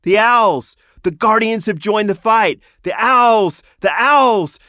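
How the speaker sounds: high ringing shout over the din; battle cry. A narrator's reading. A soldier crying out over the battlefield, shouting. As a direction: yell it across the battlefield. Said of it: "The cry went over the battlefield".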